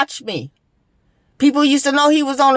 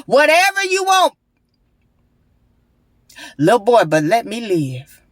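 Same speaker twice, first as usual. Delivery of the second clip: imagined plea. Retold or imitated. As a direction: beg him to spare you. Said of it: "is what I wanted to say".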